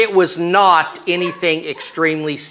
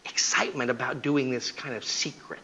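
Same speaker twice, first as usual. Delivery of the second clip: secretive hush; 8 dB quieter than usual. Retold or imitated. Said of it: "cheater explains secret thrill".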